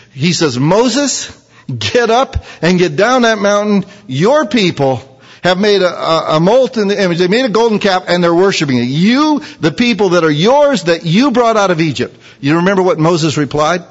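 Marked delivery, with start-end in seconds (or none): 0.6-12.1 raised, urgent voice of God; voicing God. God urging Moses down the mountain, raised and insistent. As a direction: push Moses to hurry down.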